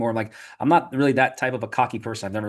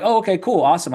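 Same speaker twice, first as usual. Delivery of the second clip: mock excited; 7 semitones higher, 5 dB louder than usual. Retold cocky reply to mentor.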